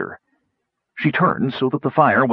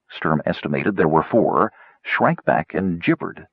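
ordinary talk

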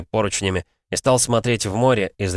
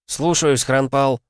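shouting; 6 semitones higher, 3 dB louder, slower than usual. A narrator's reading. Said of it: "крикнула она в ответ".

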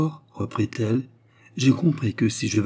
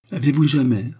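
ordinary delivery

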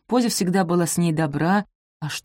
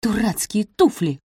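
grumbling to herself; 3 semitones higher than usual. A narrator's reading. Maid grumbles at the shoes she is brushing, muttering. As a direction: curse them to yourself. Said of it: "бормотала Софи себе под нос".

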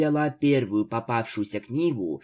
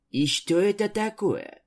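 little Isabel; 8 semitones higher than usual. A narrator's reading. Little Isabel asks timidly.

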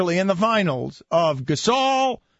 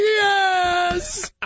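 mock cop; 11 semitones higher than usual. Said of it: "the police officers will be like".

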